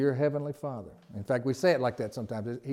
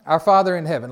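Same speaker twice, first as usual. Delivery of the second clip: proclaimed; 3 semitones higher, 9 dB louder than usual. Shown as a demonstration. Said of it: "All right".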